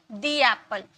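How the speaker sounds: दी एप्पल, a long "dee" with a falling end; the as "thee". Shown as a demonstration. Teacher repeats the model for students, दी said long. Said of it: "दी एप्पल बोलेंगे".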